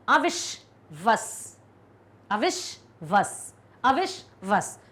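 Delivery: अविश्वस drawn out syllable by syllable, with the श in विश; slow syllable demo. Shown as a demonstration. Teacher to class, slowly sounding out the word. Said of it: "विश में शा आएगा".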